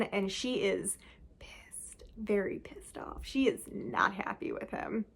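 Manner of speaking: hushed, unvoiced stage whisper; whispered gossip about a furious friend. Real, not acted. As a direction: breathe it out as a secret. Telling how furious the friend is.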